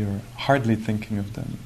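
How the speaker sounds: usual speaking voice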